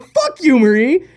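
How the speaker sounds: high, loud mock indignation; mock-outraged retort. Retorting to the tour manager's roast, mock-outraged yell. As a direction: yell back in playful outrage.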